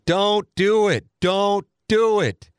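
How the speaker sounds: high, drawn-out warning call; mock yell down the hall. Frat brother shouting a warning down the hallway, mock yell. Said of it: "screaming out and down the hallway".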